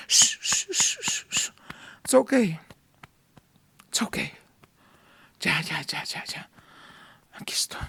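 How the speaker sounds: hushed shushing whisper, soft; whispered comfort of a father; tender hush. Acted. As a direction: soothe a crying child in a whisper. God voiced hushing a child.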